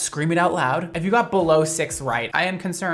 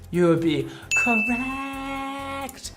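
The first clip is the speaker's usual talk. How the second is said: drawn-out reveal; 8 semitones higher, much slower than usual. Pretend.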